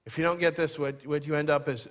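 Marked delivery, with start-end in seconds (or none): none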